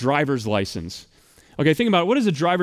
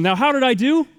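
usual speaking voice

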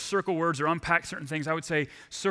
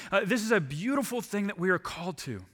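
usual speaking voice

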